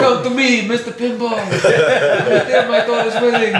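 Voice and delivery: high, slowing mock mafia boss; hammed-up Godfather-style don, amused. Addressing Mister Pinball as a mafia don, mafia voice. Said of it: "It sounds like a mafia don".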